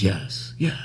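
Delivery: low, soft character impression; thinking while speaking. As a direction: imitate a brooding man mulling his answer. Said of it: "as he's speaking, I feel like he's thinking".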